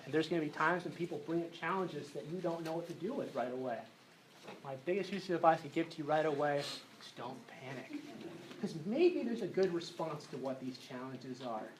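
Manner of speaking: quiet, calming, steady; soft reassurance. Real, not acted. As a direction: reassure the anxious youth gently. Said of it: "Let's all take a deep breath now".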